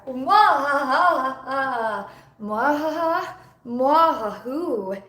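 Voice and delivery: slow hammed-up evil cackle; sneering panto villain. Evil laugh bit.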